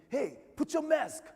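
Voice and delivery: a stranger parent yelling across the gym; commanding yell. Retold or imitated. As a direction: bark it loud and high, slow, as an order. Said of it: "he kind of yelled at me or commanded".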